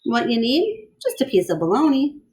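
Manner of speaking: lively storybook read-aloud; bright picture-book reading; reading to children. A narrator's reading. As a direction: read it to a class of kids.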